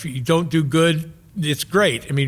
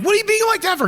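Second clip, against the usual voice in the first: much higher, baffled hippie objection; incredulous high protest; much faster than usual. Retold or imitated. Young hippie objecting to his cynical friend, high incredulous. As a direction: squeak out a naive protest. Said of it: "And I'm like".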